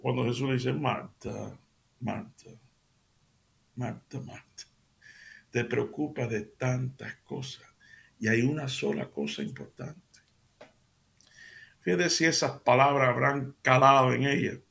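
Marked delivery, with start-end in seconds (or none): 0.7-10.2 gentle rebuke. Acted. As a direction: soothe her gently, calling her name. Jesus gently rebukes Marta. (Jesús le habla a Marta con ternura, suave)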